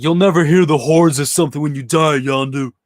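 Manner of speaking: a hint of a grizzled captain's warning; mild mock growl of a parting line; gruff movie quote. Quoting Stallone's character in the film.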